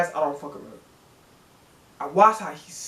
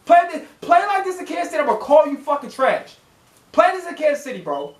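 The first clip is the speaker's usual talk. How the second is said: high, heated scolding of the player; angry rant; 6 semitones higher than usual. Real, not acted. Fan scolding the cornerback, high and angry. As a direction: shout him down for playing badly.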